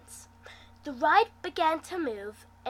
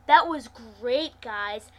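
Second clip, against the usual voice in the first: sarcastic; much slower than usual. Acted. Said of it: "his voice dropping in sarcasm".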